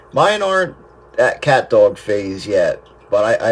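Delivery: usual speaking voice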